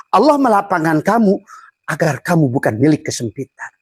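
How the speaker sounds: ordinary talk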